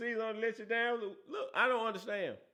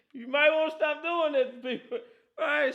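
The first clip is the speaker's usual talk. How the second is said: talking through laughter, pitched up and louder; laughing; 7 semitones higher, 5 dB louder than usual. Real, not acted. Host teasing rival fans, laughing as he speaks. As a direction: let the laugh spill into the words.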